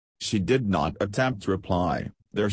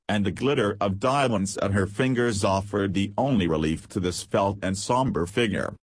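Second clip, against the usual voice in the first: usual speaking voice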